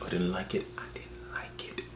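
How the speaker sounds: fading into a barely there whisper; hushed, voiceless confession; confiding whisper. Acted. Dislike repeated down into a whisper.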